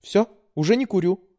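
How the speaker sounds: put-on high, pleading young woman; daughter voice. A narrator's reading. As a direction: coax like a sweet daughter. Daughter voice held.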